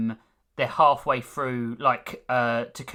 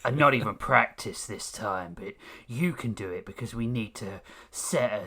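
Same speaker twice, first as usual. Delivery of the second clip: mock low deadpan; 4 semitones lower, 4 dB quieter than usual. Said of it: "the other one was like".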